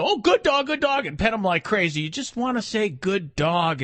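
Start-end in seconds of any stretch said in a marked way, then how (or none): 0.0-1.0 gushing, higher "Oh, good dog, good dog"; overexcited praise. Shown as a demonstration. Trainer showing the wrong, overexcited praise to a dog. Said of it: "Don't rile your dog up to". 2.9-3.8 calm, lower "Good dog"; mellow praise. Shown as a demonstration. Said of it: "and be mellow yourself".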